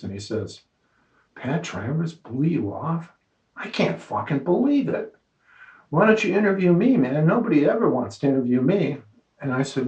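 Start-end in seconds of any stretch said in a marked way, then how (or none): 1.3-9.0 retold disbelief, pitch jumping higher on the middle; incredulous. Young guitarist stunned that [name] snubbed the writer, incredulous. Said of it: "really incredulous look on his face".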